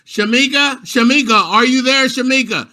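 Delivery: loud, high call for a missing student; teacher calling. Retold or imitated. Teacher on Zoom calling a student, loud and high. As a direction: call her name into the laptop, high and loud. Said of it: "heard all the teachers saying".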